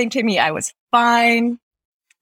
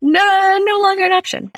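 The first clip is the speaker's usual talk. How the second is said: louder, higher flat refusal; emphatic no; 12 semitones higher, 5 dB louder than usual. Real, not acted. Guest ruling out visiting Russia, loud and high. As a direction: slam the door on the idea.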